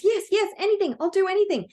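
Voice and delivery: high and fast, eager yes yes; little light agrees eagerly, high and fast; eager excitement. Acted. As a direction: agree before hearing it all. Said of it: "is so excited".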